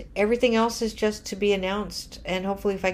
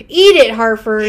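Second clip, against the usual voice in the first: loud taunt; 3 semitones higher, 15 dB louder than usual. Acted. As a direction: yell it out gleefully. Taunting newspaper again, laughing.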